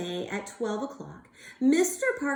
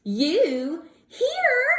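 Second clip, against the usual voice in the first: higher, louder surprised gasp; 6 semitones higher, 5 dB louder than usual. Acted. Bird finding his wife alive in the old house. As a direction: gasp in disbelief. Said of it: "gasped Mister Bird".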